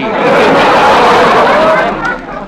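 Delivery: high hearty laugh; loud laugh. Acted. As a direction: break into laughter. Laughs at joke.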